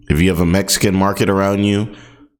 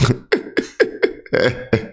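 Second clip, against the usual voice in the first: breathy laugh; 2 semitones higher, 3 dB quieter than usual. Real, not acted. Laughing at own voice.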